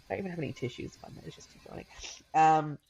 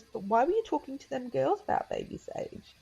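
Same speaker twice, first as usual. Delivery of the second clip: put-on higher, innocent little girl asking; child's voice; 9 semitones higher than usual. Daughter questioning mum about the girls, high childlike voice. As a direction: ask it like a curious small child. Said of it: "She goes".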